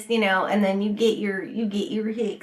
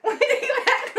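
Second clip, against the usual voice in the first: high-pitched laughing retraction; words squeaking out through a laugh; real laughter; 14 semitones higher than usual. Real, not acted. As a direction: crack up while saying it. Cracking up at own botched line.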